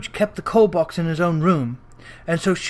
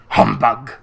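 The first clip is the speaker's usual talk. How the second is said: Scrooge scoffing; 8 semitones lower, 3 dB louder than usual. A narrator's reading.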